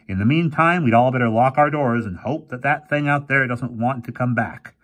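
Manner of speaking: lightly voiced cautious old professor. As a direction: plan it out, warily.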